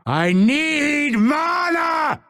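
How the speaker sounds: very high, slow drawn-out yell; desperate scream. Acted. Fighter out of mana, desperate scream. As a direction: scream it, stretching the words.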